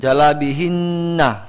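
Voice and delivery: Jalabihinna slowly, with an extra final 'na'; wrong added vowel. Shown as a demonstration. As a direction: sound the last vowel in Jalabihinna. (Jalabihinna dengan 'na' dimunculkan)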